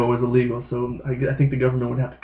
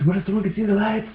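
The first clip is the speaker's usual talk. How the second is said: paranoid inner voice; 8 semitones higher, 4 dB quieter than usual. Retold or imitated. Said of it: "There was an inner monologue".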